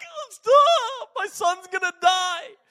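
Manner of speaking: high, loud wail of alarm; hammed-up panicked cry; panicked mother. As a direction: shriek it as a horrified mom begging. Panicked mother begs her to stop.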